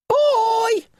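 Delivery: very high, loud creature call. A narrator's reading. Nylar calling down to Finn, very high loud call. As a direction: call down to the boy from above. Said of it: "the Nylar called again".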